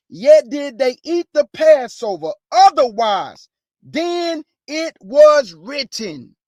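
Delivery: slow, emphatic scripture reading, loud at the end. Shown as a demonstration. Preacher rereading the verse so listeners catch it, slowly and emphatically. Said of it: "Let me read that slowly".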